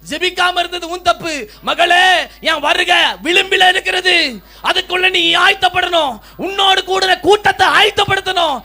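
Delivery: loud, rising preaching that builds; fiery preaching. Acted. Preacher to the church about Anna's prayer, loud rising fervour. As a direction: preach it at full force.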